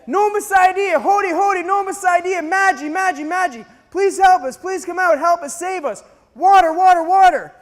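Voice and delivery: high, loud pleading shouts for help; desperate calling out. Retold or imitated. Lost travellers begging villagers for water, loud pleading shouts.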